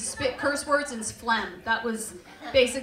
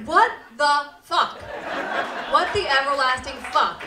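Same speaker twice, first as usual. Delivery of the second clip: louder, slowed laughing outrage; loud disbelief; 7 dB louder than usual. Real, not acted. Comic reacting to the bedtime prayer, loud laughing disbelief. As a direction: blurt it out at the prayer.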